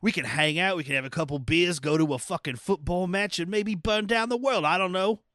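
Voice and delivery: mock Cockney with swagger; Cockney attitude. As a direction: play a chummy villain in a rough Cockney imitation. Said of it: "he's got the Cockney accent".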